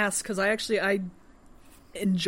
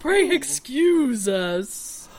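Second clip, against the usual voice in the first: posh lady voice; 6 semitones higher, 5 dB louder, slower than usual. Acted. Housekeeper Whitlow voiced.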